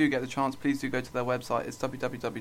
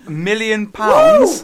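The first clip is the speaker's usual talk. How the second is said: high, loud, slow yell of joy; mock lottery-win screaming at his best friend; ecstatic shout; 9 semitones higher, 15 dB louder, much slower than usual. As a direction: scream it like you just won the lottery. Announcing million pound win.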